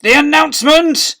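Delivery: high, loud impatient bellow. A narrator's reading. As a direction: bellow it across the hall. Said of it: "bellowed Miss Seeth".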